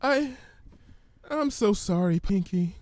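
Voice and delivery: high, hesitant frightened pony; Fluttershy's voice. Acted. Fluttershy fearfully reciting the ominous words, timid and high.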